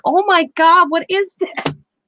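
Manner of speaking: high, slow, drawn-out disbelief; mock astonishment. Friend telling her chatmate about an ASMR video, mock astonishment. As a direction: act stunned at the weird video. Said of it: "And I'm like".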